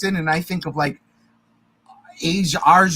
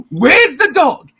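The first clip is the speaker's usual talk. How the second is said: imitating a mad demand, building louder; mock mad; 6 semitones higher, 7 dB louder, slower than usual. Quoting Superman mad at Lex about the dog, mildly mad. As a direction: repeat it harder, drawing out the second. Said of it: "He, he was mad".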